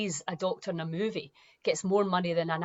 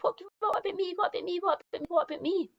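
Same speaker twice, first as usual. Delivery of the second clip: high, whiny mock "what about me"; mocking self-pity; 11 semitones higher, much faster than usual. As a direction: whine it like a selfish child.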